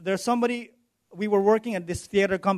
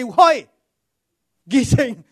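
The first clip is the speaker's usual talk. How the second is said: yelled demand, higher and louder, then a laugh; 5 semitones higher, 5 dB louder than usual. Retold or imitated. Small lady shouting at the thief to give her wallet back. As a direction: wake him up with a shout.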